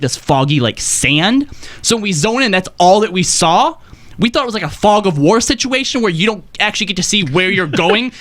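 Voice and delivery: normal talking voice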